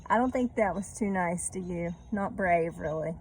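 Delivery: ordinary delivery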